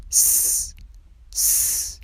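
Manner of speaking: "س" as a long, soft voiceless hiss; voiceless s sound. Shown as a demonstration. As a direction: hold "س" as pure hiss with no vowel. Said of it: "تلفظ دومش". (صدای «س» کشیده و بدون لرزه)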